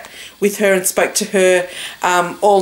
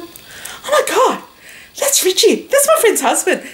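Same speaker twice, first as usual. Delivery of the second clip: high-pitched astonished realisation; acted surprised recognition; 11 semitones higher than usual. Retelling spotting a friend's husband at lunch, high surprised realisation. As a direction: replay the sudden recognition, stretched then tumbling out high.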